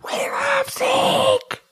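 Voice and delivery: breathy high whispered fan cry; whispered cheer. Acted.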